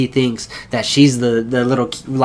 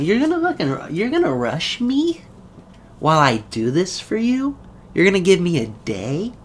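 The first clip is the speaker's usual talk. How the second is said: high, indignant mocking voice; mock annoyed retort; 5 semitones higher, 4 dB quieter than usual. Woman annoyed at Vegeta's deadline, high mocking retort. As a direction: sneer it back, pitched way up.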